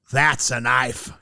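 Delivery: Crocodile Dundee quote. Pretend. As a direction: growl That's a knife slowly in a mock Aussie accent. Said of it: "He's got a knife".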